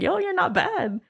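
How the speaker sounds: high, pleasantly surprised retold compliment; high-pitched "not bad" approval; impressed. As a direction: imitate a friend being impressed, pitched well up. Retells friend's impressed reaction to painting.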